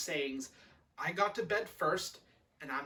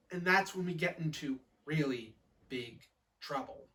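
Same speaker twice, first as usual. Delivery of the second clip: ordinary delivery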